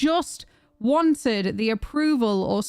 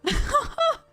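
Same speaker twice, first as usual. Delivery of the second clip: very high, drawn-out squealing shouts; real ecstatic shock. Real, not acted. Streamer learning a viewer got a job, ecstatic high-pitched shouting. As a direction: explode with joy for a friend.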